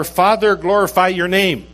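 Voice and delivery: high-pitched, declaring prayer; fervent prayer. Acted. Jesus praying to the Father for this hour, fervent prayer. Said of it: "That's his prayer".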